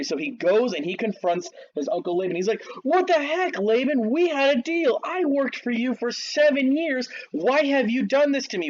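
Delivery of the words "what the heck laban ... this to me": angry confrontation. Retold or imitated. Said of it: "He's so angry".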